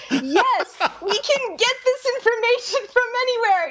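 mock high voice. Mock pronouncement.

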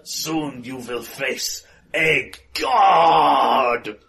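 booming villain shout. Acted. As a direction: roar the threat at them. Villain bellows.

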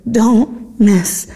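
emphatic plea; urgent emphasis. Real, not acted. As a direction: punch it like a warning. Urgent repeat to the audience.

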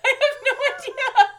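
high, loud, laughing through words; helpless laughing squeal; real laughter. Real, not acted. Cracking up at garbled words.

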